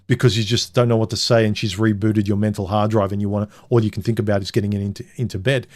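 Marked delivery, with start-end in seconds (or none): none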